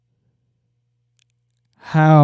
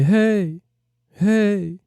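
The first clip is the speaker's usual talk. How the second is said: echoing hey; 7 semitones higher than usual. Shown as a demonstration. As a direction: call hey and let it echo back. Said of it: "So if I say".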